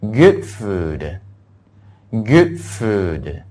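short oo in "good", long oo in "food"; "good food" as a careful vowel-contrast demo; short then long. Shown as a demonstration. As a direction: keep "good" brief and stretch "food". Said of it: "所以这句话应该读成".

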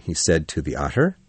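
usual speaking voice

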